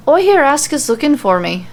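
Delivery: Becky in a light Irish lilt; lightly voiced Irish brogue, casual; Irish accent. Narrator voicing Becky, a touch of Irish accent. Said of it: "super hard to switch between accents".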